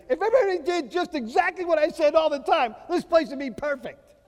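loud, high frustrated yell; yelling at the household. Retold or imitated. Father yelling at his whole household. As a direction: bellow it like a fed-up dad. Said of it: "yelling to everybody".

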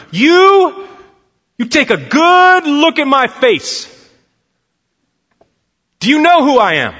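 high, loud demanding yell. Retold or imitated. Coach yelling at the professor to recognise him, loud and high. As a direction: shout it right in his face. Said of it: "if you don't stop yelling at me".

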